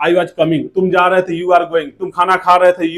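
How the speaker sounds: normal talking voice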